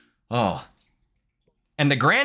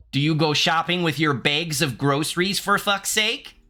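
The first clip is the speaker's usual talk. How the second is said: higher, lilting Minnesota accent; 5 semitones higher than usual. Shown as a demonstration. Demo for the chat, cheery question in Minnesota accent. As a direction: rise high at the end, Minnesotan. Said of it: "I can do a Minnesota accent".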